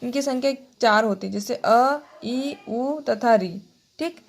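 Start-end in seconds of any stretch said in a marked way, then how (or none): 1.3-3.6 अ, ई, उ, री said short and clipped; short vowels for the class. Shown as a demonstration. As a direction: keep each vowel quick. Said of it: "बहुत कम समय लगता है".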